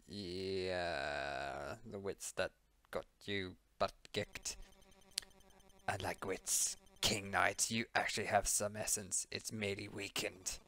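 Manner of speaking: low quiet knight voice. Acted. Voicing knight in cutscene.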